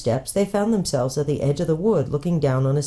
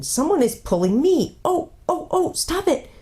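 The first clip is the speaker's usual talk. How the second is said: frightened; 14 semitones higher than usual. A narrator's reading. Susan pulled by unseen force.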